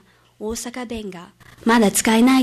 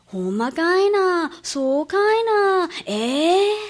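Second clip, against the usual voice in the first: higher, slow, incredulous Osaka-dialect exclamation; 8 semitones higher, slower than usual. Retold or imitated. Student acting out an Osakan's disbelief, high and slow Osaka dialect. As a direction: ham up the doubtful Osaka "really?". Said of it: "大阪弁のアクセントは違います".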